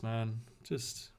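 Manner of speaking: usual speaking voice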